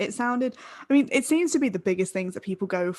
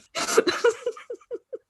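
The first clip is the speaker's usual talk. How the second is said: high giggle; 14 semitones higher than usual. Real, not acted. Laughs at her own joke.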